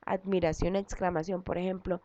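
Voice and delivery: usual speaking voice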